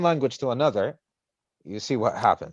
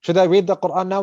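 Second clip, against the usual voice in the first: eager question; 6 semitones higher, 5 dB louder, faster than usual. Retold or imitated. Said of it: "hasten to goodness".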